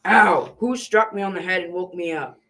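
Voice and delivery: angry struck giant. Acted. Giant woken by a blow, angry and low. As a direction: yelp and demand who did it. Giant hit while asleep.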